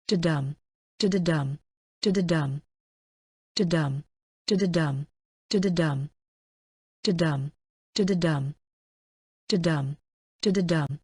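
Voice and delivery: "Da-dum, da-da-dum" with each "dum" stressed; limerick rhythm. Shown as a demonstration. Teacher demonstrating limerick beats, "da-da-dum" in anapestic rhythm. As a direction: beat out "da-da-dum" in separate bouncing feet, landing on "dum". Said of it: "Limericks are normally written in anapaest".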